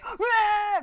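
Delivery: high, loud "rah" cry; mock roar. Imitating a character losing it over a jacket, mock roar. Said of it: "yeah, like, going".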